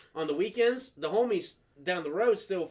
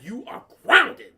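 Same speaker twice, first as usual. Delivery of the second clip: loud, drawn-out sentence, pitch raised; stern furious mom; 7 semitones higher, 10 dB louder, slower than usual. Retold or imitated. Mom punishing son after hanging up, loud anger. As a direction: land GROUNDED slowly and loudly.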